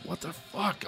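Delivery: barely audible whispered reaction; very soft, wary question; hushed disbelief. Retold or imitated. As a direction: whisper it like someone startled awake. Retold night scare, hushed reaction.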